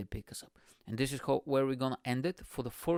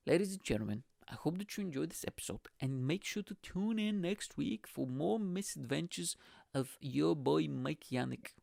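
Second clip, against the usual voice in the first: announcer outro; 4 semitones higher, 4 dB quieter than usual. Acted. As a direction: wrap up like a radio host. Podcast sign-off.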